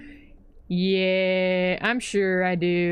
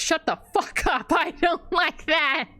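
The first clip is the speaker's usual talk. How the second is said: disgusted shout; 8 semitones higher than usual. Real, not acted. Gamer yelling at a gross snack, disgusted shout.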